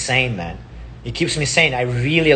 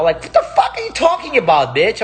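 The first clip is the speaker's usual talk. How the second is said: much higher and sharper, fed up; exasperated outburst; 7 semitones higher than usual. Real, not acted. Snapping back at fearmongering news stories, exasperated. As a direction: fling it back at the headlines in disgust. Mocking scary news headlines.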